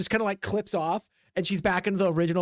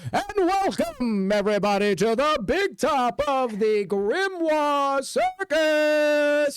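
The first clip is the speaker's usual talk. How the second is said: high, slow, grand announcer; slow, booming circus ringleader welcome; ringleader announcing; 6 semitones higher, 3 dB louder, much slower than usual. Acted. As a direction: stretch it out like a big-top ringmaster. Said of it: "they hear the ringleader go".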